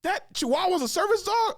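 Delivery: high rising incredulous question; squeaky outraged disbelief; mock disbelief. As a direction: sound like you can't believe it. Incredulous customer quote.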